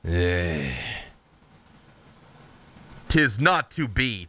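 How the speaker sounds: a dark knight's cold promise; Lord Soth. Acted. Death knight to Tatiana, slow chilling vow. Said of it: "and says".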